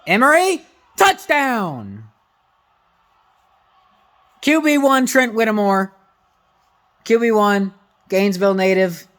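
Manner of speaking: high, loud touchdown celebration; excited shouting. Real, not acted. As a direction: yell it like your team just scored.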